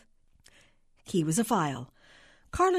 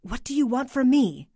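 raised, louder, rattled question; flustered clerk pushed too far; 6 semitones higher, 5 dB louder than usual. A narrator's reading. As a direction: ask it as if losing control of the call. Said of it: "Carla was flustered by the voice".

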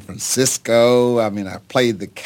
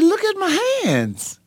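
much higher, pleading with his father to look at his hands; astonished cry. Retold or imitated. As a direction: cry it out in awe, pitched way up. Young man shows his father his hands.

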